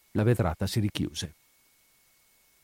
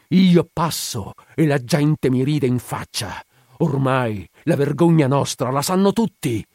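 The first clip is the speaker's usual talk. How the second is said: loud, raised-pitch acted tirade; angry reproach; 4 semitones higher, 6 dB louder than usual. A narrator's reading. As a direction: accuse your wife, building in force. Husband's bitter tirade at his wife. (rimprovero rabbioso, a voce alta)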